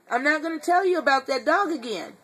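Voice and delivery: ordinary talk, nothing marked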